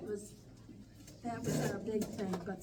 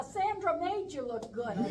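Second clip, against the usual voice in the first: laughing; 8 semitones higher, 8 dB louder than usual. Real, not acted. Laughing while teasing Harold.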